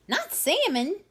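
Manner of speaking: high, loud squawking; thrilled disbelief. A narrator's reading. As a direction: screech in disbelief. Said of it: "screeched the pelican".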